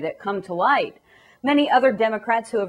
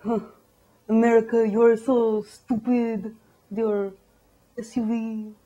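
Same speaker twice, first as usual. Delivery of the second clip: sneering foreigner; 2 semitones lower than usual. Retold or imitated. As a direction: look down your nose at America. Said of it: "the rest of the world is like".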